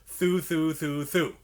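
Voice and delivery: four even THROUGHs on one flow of air; tongued syllables. Shown as a demonstration. Recorder teacher showing tonguing four times, connected tongued syllables. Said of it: "tonguing through four times".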